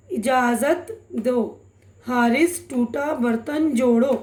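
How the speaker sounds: slow, deliberate word by word; slow reading demo. Shown as a demonstration. Teacher reading a practice sentence slowly to students. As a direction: read it out slowly for learners to follow. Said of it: "तो अच्छी तरह से आप ये पढ़ लेंगे".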